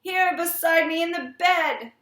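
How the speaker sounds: high, loud panicked cry; shrieked in horror, high and loud; terrified scream. A narrator's reading. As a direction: scream it as if tearing a veil away. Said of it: "he screamed sharply".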